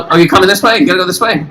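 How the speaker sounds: ordinary talk, nothing marked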